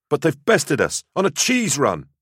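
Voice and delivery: high-pitched indignant protest. A narrator's reading. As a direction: protest with the pitch pushed right up. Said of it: "Natus protested".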